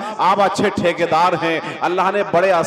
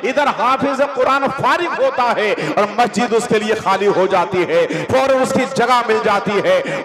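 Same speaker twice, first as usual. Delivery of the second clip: preaching; 6 semitones higher, 3 dB louder than usual. Acted.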